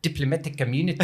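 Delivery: playful South African accent attempt. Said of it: "You wanna give us a try?".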